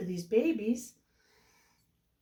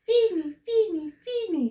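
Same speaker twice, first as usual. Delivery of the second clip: hungry duckling cry; 8 semitones higher than usual. Acted. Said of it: "cried the hungry baby duck".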